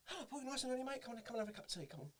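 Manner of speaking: high-pitched, chirpy welcoming voice; Simon. Retold or imitated. Assistant director greeting the actor, high welcoming voice. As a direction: put on a high voice ushering someone in. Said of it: "A guy called Simon".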